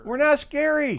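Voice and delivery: loud, very high-pitched clown denial; mock protest. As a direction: protest in a hammed-up squeaky yell. Clowns mock-protest being called scary.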